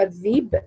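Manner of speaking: "الذيب" with a long ii and no glottal stop; hamza turned to ya. Shown as a demonstration. Teacher shows the reading, "الذيب" with ya for hamza. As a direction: glide smoothly through "الذيب" as adh-dhiib. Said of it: "أبدل الهمزة ياء".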